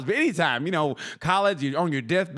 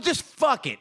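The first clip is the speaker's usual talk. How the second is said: fed-up; 8 semitones higher, slower than usual. Retold or imitated. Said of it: "I almost said".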